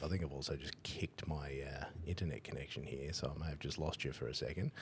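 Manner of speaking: softly muttered to himself, half under breath; muttered aside. Real, not acted. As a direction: drop the voice and grumble it quietly. Muttering about his dropped connection.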